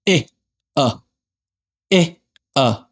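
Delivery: short, separated marching-count syllables; squad leader. Retold or imitated. Teacher imitating squad leader's one two, short military count. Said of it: "squad leader always says something like".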